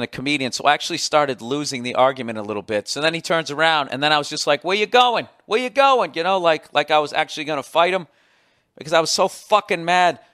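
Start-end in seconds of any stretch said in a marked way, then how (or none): 4.6-6.1 high, loud challenge; calling out. Retold or imitated. Comic calls after the heckler walking away, loud challenge. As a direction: call after him, itching to fight.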